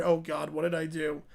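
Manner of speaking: regretful Banjo. Acted.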